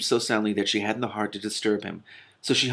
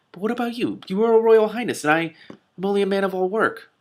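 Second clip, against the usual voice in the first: put-on high, pleading young man; earnest Peregrine; 11 semitones higher, 4 dB louder than usual. A narrator's reading. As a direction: lay out the gap between them. Peregrine worried about rank gap.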